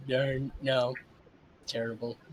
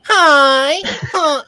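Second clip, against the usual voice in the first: put-on anime girl voice; 10 semitones higher, 7 dB louder than usual. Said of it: "I like it when they go".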